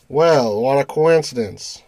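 normal talking voice

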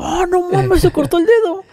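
squeaky surprised voice, high up; high-pitched mock shock. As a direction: fake alarm in a high voice.

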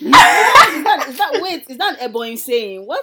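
loud high laughing question. Real, not acted. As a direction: ask through laughter. Laughing shock.

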